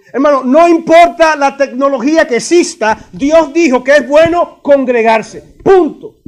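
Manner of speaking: loud, emphatic pulpit delivery; forceful preaching. Real, not acted. Preacher insisting to brothers on gathering, forceful preaching. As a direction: drive it home, ending on a high, loud full stop.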